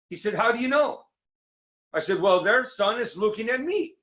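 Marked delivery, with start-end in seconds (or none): 0.3-1.0 high quoted question. Retold or imitated. Quoting the other in the joke. 2.2-4.0 high punchline delivery. Retold or imitated. Joke punchline quote.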